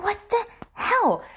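high, bewildered outburst; freaking out. Retold or imitated. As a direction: react in stunned alarm. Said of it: "so I'm freaking out here".

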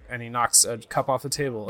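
usual speaking voice

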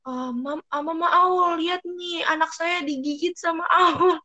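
high, slow tattling mother's voice; an upset neighbor complaining to Mama Aul; complaining parent. Retold or imitated. (ibu-ibu mengadu dengan suara tinggi)

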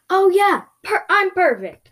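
loud, high-pitched sarcasm; sarcastic. Acted. To the creature asking after them, sarcastic. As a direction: overdo the fake cheerfulness. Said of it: "I said sarcastically".

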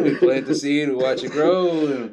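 loud, high folksy farmer; tomato farmer voice. Retold or imitated. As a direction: boom it out like a proud farmer. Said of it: "the same answer as a tomato farmer would give you".

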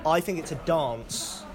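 "dance" with a long broad British "ah"; British pronunciation. Shown as a demonstration. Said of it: "chatting to some of the campers exactly about the discrepancies".

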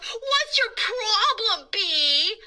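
high, slow, loud yell. Acted. Boy yells at the silent bee, slow and high. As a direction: throw up your hands and yell.

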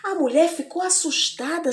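usual speaking voice

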